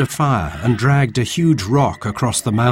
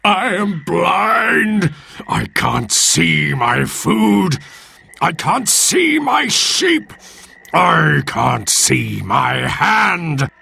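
slow, high anguished wail; monster's yell; 6 semitones higher, slower than usual. Acted. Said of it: "it yelled".

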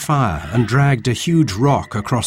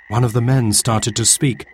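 ordinary delivery

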